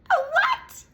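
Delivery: shrill surprised squeal; mock shock.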